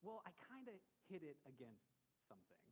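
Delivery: lightly voiced sheepish wife breaking bad news; a hint of a nervous, placating wife; gently imitating his wife owning up; don't-be-mad tone. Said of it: "it was kinda that tone".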